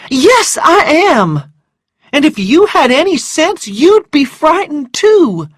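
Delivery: high, loud, agitated comeback; upset and indignant, pitched high; frightened retort. Acted. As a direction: fire back in a high, scared voice. Frightened Scarlett snaps back at Rhett.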